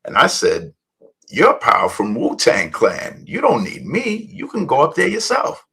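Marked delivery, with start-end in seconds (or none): 1.3-5.6 high-pitched, disbelieving retold protest; incredulous reply. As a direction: pitch jumps up as if stating the obvious. Retelling his amazed reply to Power.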